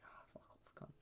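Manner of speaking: whispered, barely audible dismissal; put-on muttering voice. Friend imitates the guy doing that voice, whispered mutter. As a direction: imitate someone cursing under their breath. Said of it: "that kind of voice".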